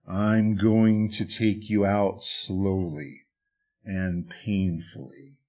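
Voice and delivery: low menacing rumble. Acted. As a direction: draw the threat out, sinking low. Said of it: "in a low and menacing rumble".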